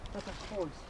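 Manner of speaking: hushed, almost under the breath; barely voicing it in disbelief; a quiet stunned mutter; awed murmur. Real, not acted. Awed murmur at big fish.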